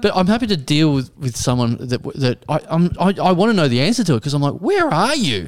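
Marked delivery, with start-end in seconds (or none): 4.5-5.5 high-pitched, incredulous question; exasperated disbelief. Retold or imitated. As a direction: demand it in mock bewilderment. Exasperated at lost customer.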